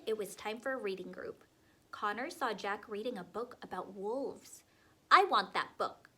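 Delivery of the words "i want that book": loud, grabby demand. A narrator's reading. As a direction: insist on it loudly like a spoiled kid. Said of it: "Connor demanded".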